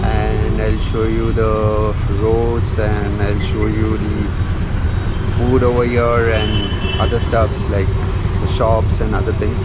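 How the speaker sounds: muffled through a helmet; talking with a helmet on; inside a helmet. Real, not acted. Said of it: "I'm wearing helmet".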